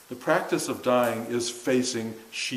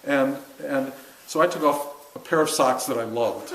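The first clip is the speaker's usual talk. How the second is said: ordinary talk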